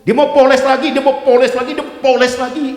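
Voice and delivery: high, loud, driving sermon shout; preaching at full force. Acted. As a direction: hammer it out to the congregation. (khotbah berapi-api, lantang dan tinggi)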